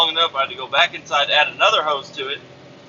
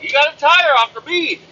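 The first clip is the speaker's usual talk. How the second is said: high, raised voice spelling it out; exasperated repeat; 11 semitones higher, 3 dB louder than usual. Retold or imitated. Driver repeating to clueless coworker, exasperated. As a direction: say it up high for someone who didn't get it.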